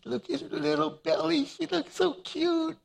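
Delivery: put-on high-pitched gushing over a baby bump; cooing admirer. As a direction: coo softly in a put-on high voice. Cooing over a pregnant woman's belly.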